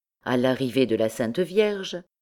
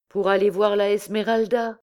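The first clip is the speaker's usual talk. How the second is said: plaintive, higher-pitched answer; piteous; 6 semitones higher than usual. A narrator's reading. Actor Jupiter answering the poet about the ladder, piteous. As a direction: answer pitifully, voice lifted. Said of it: "répondit piteusement Jupiter".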